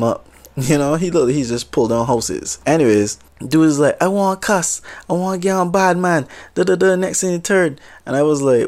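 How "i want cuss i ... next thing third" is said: higher-pitched Jamaican patois, worked up; retelling a hot-headed Jamaican customer at the counter, in Jamaican accent. Retold or imitated. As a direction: imitate an angry Jamaican customer in a light Jamaican accent. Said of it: "maybe I'll just try the accent just a little bit".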